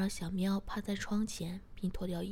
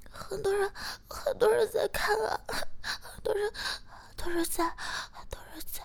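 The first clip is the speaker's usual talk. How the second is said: flustered pleading; 13 semitones higher, 4 dB louder than usual. A narrator's reading. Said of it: "并细声地说".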